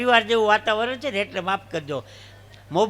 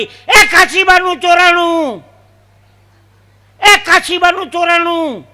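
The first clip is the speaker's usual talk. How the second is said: loud, high, drawn-out shout; sudden alarm yell; 9 semitones higher, 11 dB louder, slower than usual. Acted. Passenger cries out about a theft on the night train, yelling. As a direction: raise the alarm across a sleeping train. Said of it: "એક જણે ઓચિંતાની બૂમ પાડી".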